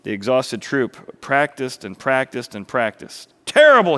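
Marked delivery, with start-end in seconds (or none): 3.5-4.0 high, loud barked verdict; explosive angry shout. Acted. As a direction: bark it at a failing cast. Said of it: "he barked".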